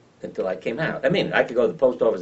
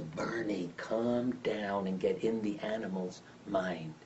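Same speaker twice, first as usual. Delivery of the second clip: calm wife; 9 dB quieter, slower than usual. Retold or imitated. Wife calming him down.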